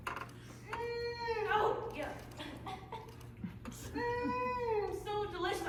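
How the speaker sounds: pleasure. Acted. Secretly loving the food.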